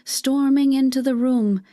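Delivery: ordinary delivery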